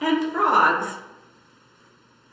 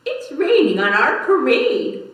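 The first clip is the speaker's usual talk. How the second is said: wailing; 4 semitones higher, 6 dB louder than usual. Acted. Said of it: "wailed one".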